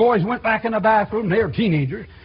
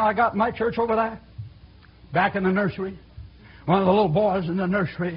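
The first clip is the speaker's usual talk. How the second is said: normal talking voice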